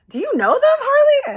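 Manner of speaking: very high, loud squeal of disbelief; acted starstruck shriek; amazed question. Amazed, asks if he knows band.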